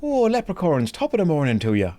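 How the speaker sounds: high, lilting stage-Irish accent; mock a leprechaun-style Irish brogue, starting high. Shown as a demonstration. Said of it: "the Irish accent is not just the stereotypical".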